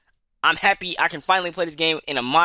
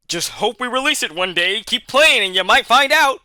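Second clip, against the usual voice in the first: hammed-up smug developer, higher and louder; mocking developer voice; 7 semitones higher, 6 dB louder than usual. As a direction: taunt the fans like a smug dev, pitched up. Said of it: "Versus, "Hey".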